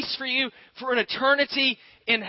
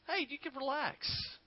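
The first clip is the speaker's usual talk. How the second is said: hushed and slow, much softer; soft reassurance; 5 semitones higher, 13 dB quieter, slower than usual. Retold or imitated. Preacher voicing Scripture to a worried congregation, hushed. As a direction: ease the listener gently. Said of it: "simply is telling us".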